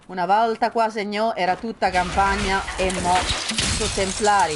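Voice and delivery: put-on loud Italian in a Roman accent. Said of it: "I start to speak in Roman".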